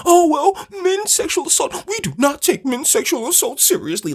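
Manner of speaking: very high, mocking whine in a put-on voice; weak whiny complainer. Host mocks men complaining about assault, very high whine. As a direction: ham up a feeble whine. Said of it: "y'all sound so weak when y'all do this".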